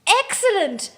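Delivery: a sudden high, loud exclamation; excited burst. A narrator's reading. Edward delighted at her reply, high excited burst. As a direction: blurt it out with delight. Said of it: "Edward gusted".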